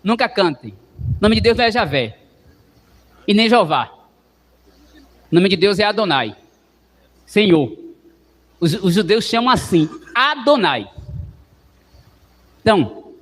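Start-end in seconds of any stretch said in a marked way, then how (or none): none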